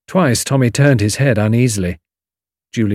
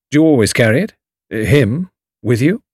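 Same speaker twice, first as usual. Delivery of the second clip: eager curious questions; burning curiosity; 3 semitones higher than usual. A narrator's reading. Tommy asking Julius about the gun, eagerly curious. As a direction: lean in, dying to know. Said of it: "with burning curiosity".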